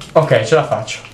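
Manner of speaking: ordinary talk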